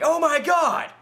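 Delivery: retold freakout. Itchy face from dolphins, panicking. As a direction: yelp it like you're freaking out. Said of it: "I'm just there freaking out".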